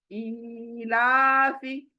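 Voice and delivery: ईलाफी with the ई drawn out long; lengthened ee. Shown as a demonstration. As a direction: stretch the opening ई of ईलाफी for a full beat. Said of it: "अभी यहाँ पर देखें". (ईलाफी में ई को लंबा खींचकर)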